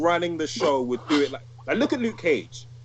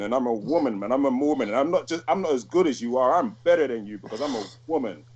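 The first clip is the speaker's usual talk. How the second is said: ordinary talk, nothing marked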